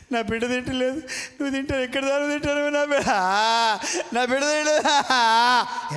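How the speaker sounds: mock whine of a jealous mother; whiny complaining mother. Preacher mocking mother-in-law's complaint, whiny mimicry.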